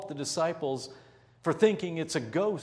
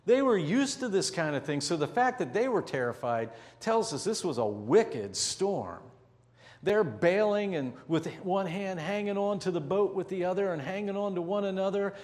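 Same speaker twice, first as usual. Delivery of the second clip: ordinary talk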